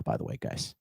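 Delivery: breathy whispered afterthought; confiding whisper to the viewers; whispered aside. Real, not acted.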